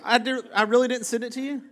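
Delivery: high-pitched incredulous question; double-check in genuine disbelief; real disbelief. Real, not acted. Disbelief that the clip was not sent.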